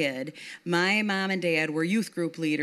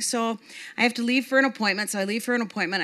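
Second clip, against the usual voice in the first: ordinary talk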